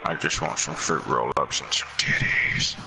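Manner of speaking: low, breathy hillbilly whisper; Carl Childers voice. Acted.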